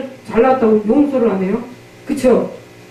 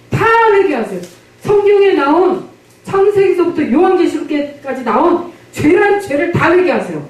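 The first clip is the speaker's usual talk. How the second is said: preaching exhortation; 5 semitones higher than usual. Acted. Exhorts congregation to repent.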